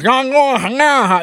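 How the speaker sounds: high, loud, slurred mock mumble; hammed-up slurred nah nah; mush-mouthed mumble. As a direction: mimic a mush-mouthed kid who can barely be understood. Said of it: "someone with a deep Cajun accent with someone who is deaf".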